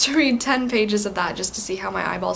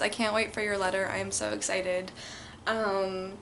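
normal talking voice

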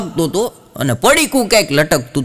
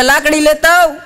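higher, louder command; shouting order; 12 semitones higher, 4 dB louder than usual. Retold or imitated. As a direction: yell it at the son. Grandpa shouts for a stick.

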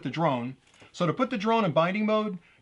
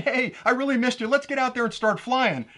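lightly acted, higher, eager voice; voiced controller; 7 semitones higher, 3 dB louder than usual. Controller greets the long-lost drone, lightly voiced higher. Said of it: "the controller's saying".

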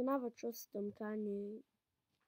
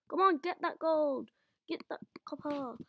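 ordinary talk

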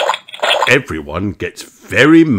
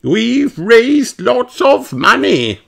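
much higher, louder teacher call; Madame Gazelle's voice; 5 dB louder, slower than usual. Acted. Madame Gazelle addressing the children, high and loud. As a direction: call the class to order. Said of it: "says Madame Gazelle".

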